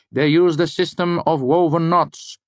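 high-pitched eccentric lecturer, correcting; Elodin. Acted. Lecturer contradicting a student about written language, high-pitched.

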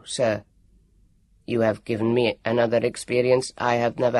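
a polite, precise machine; calm android. Acted. Elio the android thanking the warrior, low and even.

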